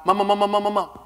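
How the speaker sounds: high, stuck stammer on "mama"; imitated stutter. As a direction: repeat "mama" as a rapid stuck syllable. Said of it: "My stutter starts to dissipate".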